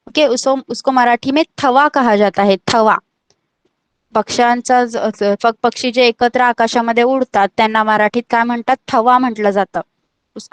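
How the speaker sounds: usual speaking voice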